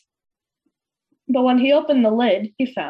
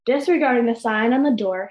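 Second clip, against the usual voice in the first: ordinary delivery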